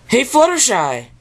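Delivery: bright friendly hail; Pinkie Pie. Acted. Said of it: "said Pinkie".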